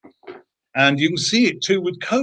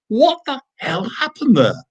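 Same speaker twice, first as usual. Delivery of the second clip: high, slow disbelief; mock bewildered outburst, pitched high; baffled; 10 semitones higher, slower than usual. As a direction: retell the team's stunned question. Team baffled after meeting.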